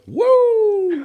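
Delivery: high, loud wrestler whoop; Ric Flair. Retold or imitated. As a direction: belt out a huge wrestler woo. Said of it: "a ghost keeps Ric Flairing him".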